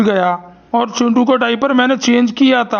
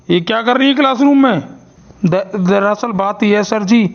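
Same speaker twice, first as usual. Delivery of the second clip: usual speaking voice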